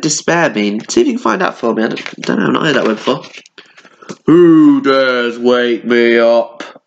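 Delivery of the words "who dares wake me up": slow, louder growling bear voice. A narrator's reading. Angry bear woken from sleep: slow loud growl. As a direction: growl it slowly like a big bear. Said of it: "growled the bear angrily".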